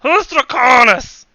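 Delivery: very high, loud radio villain in mock Russian; slow, hammed-up Russian villain; wobbly Russian accent. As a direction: taunt the players in a bad Russian accent. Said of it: "what's going on with my accent".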